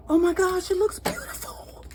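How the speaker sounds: high, gasping delight; excited gasp. Real, not acted. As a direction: gasp with thrilled surprise. First look inside homemade loaf.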